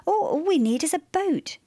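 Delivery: high, eager conclusion; carried away by the idea; enthusiastic, stumbling into the plan; swept-up enthusiasm. A narrator's reading. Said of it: "swept up by her own enthusiasm".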